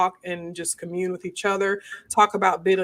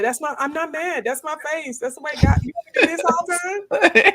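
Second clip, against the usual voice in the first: high, exasperated denial; exasperated protest; 8 semitones higher, 3 dB louder than usual. Retold or imitated. Telling people who think she's mad, high protesting voice. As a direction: insist in a raised voice that it's just your face. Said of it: "I tell people that".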